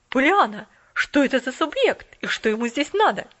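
loud, stern questioning; stern lady, loud. A narrator's reading. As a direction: mistress demanding answers.

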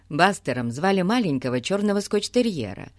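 ordinary delivery, nothing marked

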